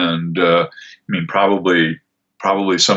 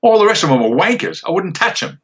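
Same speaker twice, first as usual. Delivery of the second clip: put-on higher, dismissive Australian accent in character; contemptuous Australian accent, pitched up; Sniper's Aussie voice; 10 semitones higher than usual. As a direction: sneer it like a cocky Aussie marksman. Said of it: "to do the Aussie voice".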